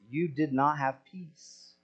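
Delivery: usual speaking voice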